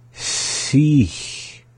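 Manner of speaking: SICH stretched, high, long S and long hissed ending; slowly. Shown as a demonstration. Teacher attempting to slow a subtle word, slowly. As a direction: draw out both hisses in SICH. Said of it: "Kind of a hard one to slow down".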